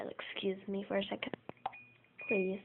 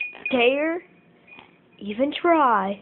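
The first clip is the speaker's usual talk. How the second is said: loud warning; 4 semitones higher, 13 dB louder, slower than usual. Real, not acted. Shouting at the parrot.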